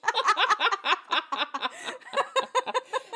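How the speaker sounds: high helpless laugh; long laugh. Real, not acted. Laughing with her friend about the Gary reveal. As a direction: laugh hard at the twist.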